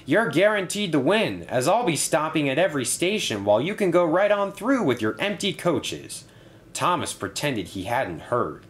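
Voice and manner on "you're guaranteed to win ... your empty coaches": high-pitched, mildly amused taunt; lightly teasing, higher than usual; chuckling tease. A narrator's reading. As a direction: needle them playfully in a raised voice. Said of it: "chuckled Bertie".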